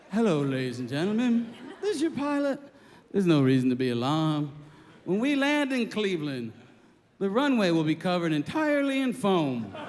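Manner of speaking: low, soothing mock announcement; mellow pilot. Pilot briefing passengers before crash landing, deep and mellow. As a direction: imitate an eerily calm captain. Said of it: "the pilot got on again".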